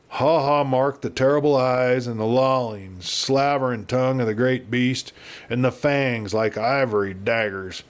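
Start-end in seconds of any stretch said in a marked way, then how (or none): none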